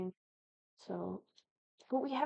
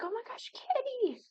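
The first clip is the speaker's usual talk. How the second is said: high, bright-eyed call of oh my gosh kitty; excited call to a kitty; 13 semitones higher, 3 dB louder than usual. Retold or imitated. Retelling spotting the cat, an excited high call.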